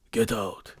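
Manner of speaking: low, soft, menacing Viking growl. Pretend. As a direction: drop into a deep threatening Viking order. Viking ordering the kids out.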